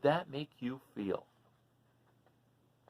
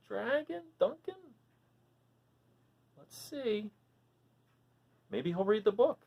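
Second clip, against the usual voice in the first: ordinary delivery, nothing marked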